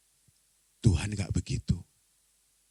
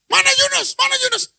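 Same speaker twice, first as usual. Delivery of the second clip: high, loud demand; acted shout; 10 dB louder than usual. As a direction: shout out for the culprit across a crowd. Penumpang mencari Yunus yang terpilih. (berteriak lantang mencari orang)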